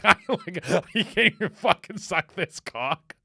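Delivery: sneering through laughter; laughing mockery. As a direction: laugh at her contemptuously. Mocking boyfriend laughing.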